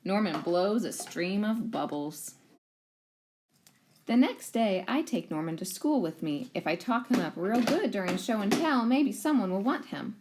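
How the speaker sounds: ordinary delivery, nothing marked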